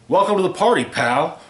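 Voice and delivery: delighted yell. Acted. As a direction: shout it with glee. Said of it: "John yelled with delight".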